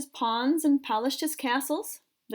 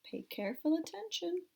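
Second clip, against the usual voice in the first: quiet aside; 2 semitones lower, 12 dB quieter than usual. Real, not acted. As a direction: drop to a soft aside. Teacher aside to child listeners.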